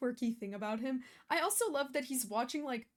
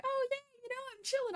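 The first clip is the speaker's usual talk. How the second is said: high, bright-eyed mock glee, much higher; giddy cheer; much faster than usual. Reactor mocking her early carefree mood, high giddy cheer. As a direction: play your own carefree cheer back, sky-high. Recalls her happy start of episode.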